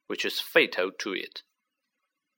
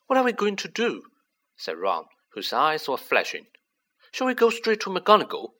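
higher, eager questions; excited; 7 semitones higher than usual. Acted. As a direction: ask it all fired up. Ron eager, eyes flashing.

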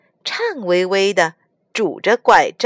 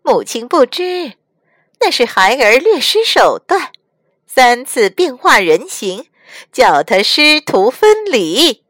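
gloating demon; 5 semitones higher, 5 dB louder than usual. Acted. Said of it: "白骨精哈哈大笑".

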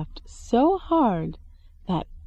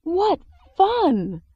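much higher gleeful cry; delighted king, much higher; 10 semitones higher, 4 dB louder than usual. Acted. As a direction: squeal with glee.

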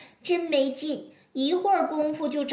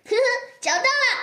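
happy excited; 9 semitones higher, faster than usual. Acted. Kids find animals joyfully.

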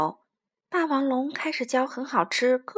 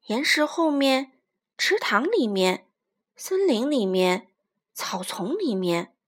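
ordinary delivery, nothing marked

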